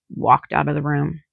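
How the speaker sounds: plain reading voice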